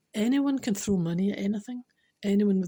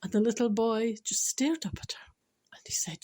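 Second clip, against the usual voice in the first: ordinary talk, nothing marked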